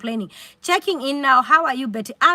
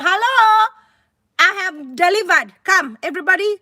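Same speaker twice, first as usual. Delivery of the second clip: high, loud call across the fields; announcing the birth to the women outside; 6 semitones higher, 4 dB louder than usual. Retold or imitated. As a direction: hail the women from afar. Calling the women from the farm.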